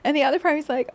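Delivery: usual speaking voice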